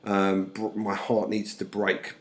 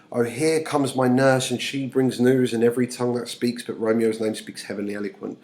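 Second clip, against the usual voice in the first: raised, louder opening easing off; dramatic play reading; 3 semitones higher, 6 dB louder than usual. Acted. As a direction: read Juliet's verse out theatrically. Reads Juliet's lines aloud.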